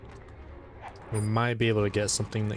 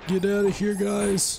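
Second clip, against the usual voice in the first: high playful shout; 10 semitones higher, 3 dB louder, slower than usual. Real, not acted. Gamer yelling at figures blocking the ball, high and loud. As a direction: yell it high and loud at them, half laughing. Shooing game figures away.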